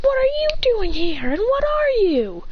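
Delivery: very high-pitched startled questions; high acted shock; alarmed questioning. A narrator's reading. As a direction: squeal it in alarm. Startled by a Marshadow.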